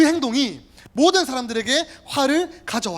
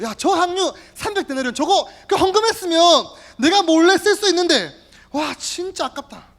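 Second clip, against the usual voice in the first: higher, louder covetous grumbling; greedy Judas; 7 semitones higher, 6 dB louder than usual. Acted. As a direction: begrudge every coin of it. Judas regretting lost money.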